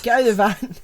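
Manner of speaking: high, loud mock film yell; Morgan Freeman. Repeating the film line for laughs, hammed-up Freeman impression. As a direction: shout it as a badly faked impression. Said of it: "Morgan Freeman impression".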